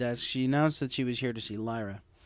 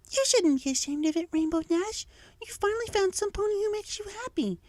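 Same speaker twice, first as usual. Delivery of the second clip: encouraging Fluttershy. A narrator's reading. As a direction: gently cheer her on. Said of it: "Fluttershy encouraged".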